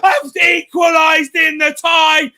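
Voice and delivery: screaming the goal at full volume. Real, not acted. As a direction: yell it like your team just scored. Live goal reaction shouting commentary.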